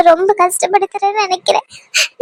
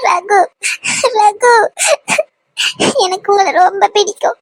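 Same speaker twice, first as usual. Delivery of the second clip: ordinary talk, nothing marked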